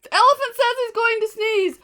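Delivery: high, loud parrot squawk; shrieking parrot. Acted. Parrot warning the others, a high loud shriek. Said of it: "With a shriek".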